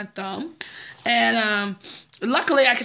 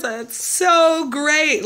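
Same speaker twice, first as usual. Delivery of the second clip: retold excitement; 5 semitones higher, 5 dB louder, much slower than usual. Retold joy at the low price.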